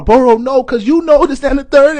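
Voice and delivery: imitating her high, loud, upset outburst; mock angry cry. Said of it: "she start crying, that angry cry".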